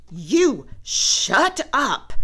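snapping. A narrator's reading. Sister snapping at brother, loud slow snap. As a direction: snap it back hard. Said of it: "snapped Sister".

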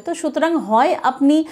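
ordinary delivery

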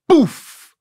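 a high, loud burst for the light snapping on; big light flicks on with a poof; sudden poof. Retold or imitated. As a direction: pop it out like the whole room lighting up at once. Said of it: "the whole room is like".

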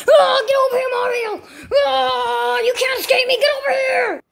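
high, roaring chase yell; raging Donkey Kong. Acted. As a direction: roar as you charge after someone.

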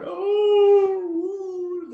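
high, loud drawn-out howl; dog howl. Acted. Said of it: "she's howling".

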